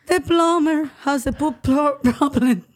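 garbled words round a mouth stretcher; mouth stretched open. Real, not acted. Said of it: "hard to get the shit part out of your mouth".

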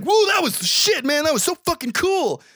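high, thrilled whoop of a retold quote; hyped-up excitement. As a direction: act the buddy buzzing after a fight. Retelling hyped friend after fight.

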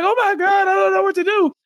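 high, hammed-up panic; mock-wailing overwhelmed wife; mock distress. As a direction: whine it like a helpless wife. Mocking Jasmine's distress.

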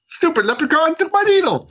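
put-on squeaky gripe; high-pitched comic complaint. Addict griping about his lost needle, high comic complaint. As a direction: whine it out very high, mock indignant.

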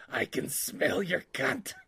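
slow, leering inmate voice; Miggs quote. Retold or imitated. Explaining the quote to his cohost, slow leering. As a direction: draw it out like a leering madman. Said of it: "he's the guy that goes".

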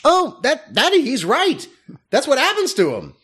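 high, excited realization; imitating a high excited eureka; Leonardo DiCaprio thing. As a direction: point and react like the movie scene. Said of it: "did the Leonardo DiCaprio thing".

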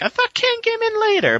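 very high, squeaky-pitched self-quote; mock confusion. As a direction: mimic your own baffled reaction up high. Quotes own puzzled reaction.

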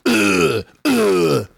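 imitated high grunts. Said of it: "like, all these grunts".

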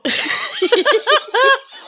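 a real, high burst of giggling; high giggle. Real, not acted. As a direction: she can't keep it in. Laughs after her own line.

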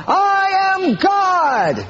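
high, drawn-out proclaiming cry; mock Shirley MacLaine. Preacher mocking an actress on the seashore, drawn-out high cry. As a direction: stretch it out like a grand revelation. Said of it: "she's saying".

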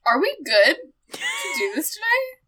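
mock shout. Loud mock call out.

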